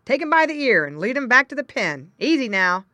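loud farmer directing his dog; calling out instructions. A narrator's reading. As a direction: call orders over to the dog. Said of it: "called the farmer".

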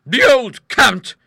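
loud, high, drawn-out scorn; slow, emphatic outburst; indignant cry. A narrator's reading. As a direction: cry it out contemptuously. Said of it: "she cried".

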